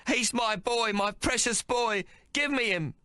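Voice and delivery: high, pleading cry; desperate mother. Acted. Mary begging the monster for her son, high desperate cry. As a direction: beg for your child, voice lifted high.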